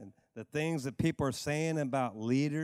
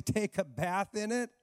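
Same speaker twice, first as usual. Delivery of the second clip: normal talking voice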